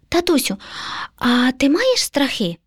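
put-on high, hesitant child voice, drawn out; little son asking. A narrator's reading. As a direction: slowly ask daddy a delicate question. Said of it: "запитав синочок".

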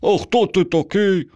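put-on high little boy voice, asking; curious boy. A narrator's reading. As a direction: ask it like a small naive child. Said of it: "спитав Ваня". (високий наївний дитячий голос)

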